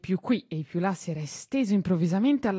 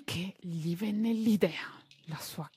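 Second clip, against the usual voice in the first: breathy secret whisper; 7 dB quieter, slower than usual. A narrator's reading. Tistù's big idea is revealed. (sussurrato, come un segreto)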